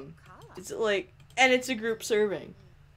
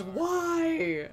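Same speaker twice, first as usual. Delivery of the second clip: normal talking voice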